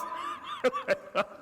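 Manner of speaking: real laugh breaking out high; helpless high laughter. Real, not acted. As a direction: burst into a high cackle. Laughing at his own story.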